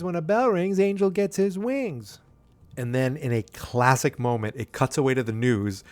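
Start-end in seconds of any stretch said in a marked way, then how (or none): 0.0-2.2 high-pitched, slowing recital; imitated film child. Quoting the bell line from the film, imitated child.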